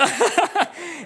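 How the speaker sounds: high teasing chuckle; hammed-up laugh; playful high cackle; mock laugh. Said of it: "then I laugh like that".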